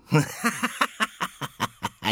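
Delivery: high, shrill cackle; shrill witch laugh. A narrator's reading. Third witch cackling as she bows. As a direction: cackle like a gleeful hag. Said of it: "mit einem grellen Lachen".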